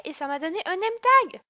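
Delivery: ordinary talk, nothing marked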